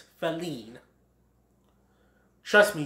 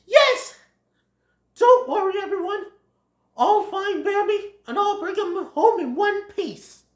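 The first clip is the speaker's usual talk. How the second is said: high, loud, eager Thomas voice; eager promise; 6 dB louder than usual. Acted. Thomas promises to find Bambi, eager high voice. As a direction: launch in loudly, then keep it high.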